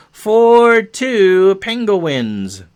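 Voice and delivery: big, slow sportscaster flourish; drawn-out score announcement. Real, not acted. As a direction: stretch each word long and loud.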